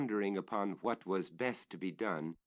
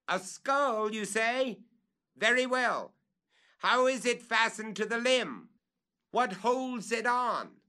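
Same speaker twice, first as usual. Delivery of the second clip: much higher, louder, slowing questions; calling up; 13 semitones higher, 6 dB louder than usual. Acted. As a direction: press him for details.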